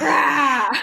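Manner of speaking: roaring through laughter; big roar breaking into laughs; laughing roar. Pretend. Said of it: "it is a good roar".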